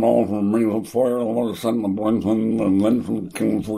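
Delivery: rushed, nasal Cornish mumble of the verse; a young Cornish student garbling Henry the Fifth; imitating his old nasal Cornish accent. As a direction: gabble Shakespeare through the nose in broad Cornish. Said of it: "speaking through the nose and a Cornish accent".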